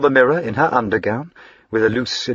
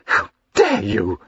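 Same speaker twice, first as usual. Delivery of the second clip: gasped. Acted. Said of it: "she gasped".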